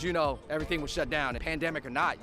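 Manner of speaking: ordinary talk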